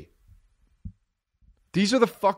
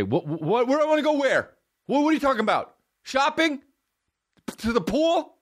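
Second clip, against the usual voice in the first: very high, mock-bewildered questioning; baffled exasperation; 12 semitones higher than usual. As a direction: play an exasperated listener, pitch shooting up. Acted baffled listener demanding the missing part.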